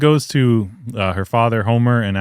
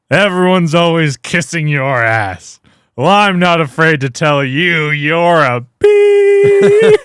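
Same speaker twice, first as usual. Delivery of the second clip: put-on slow, slurred drunk rant; drunk slurring; 6 semitones higher, 6 dB louder, slower than usual. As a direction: slur it like a drunk clown. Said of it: "slurring his words".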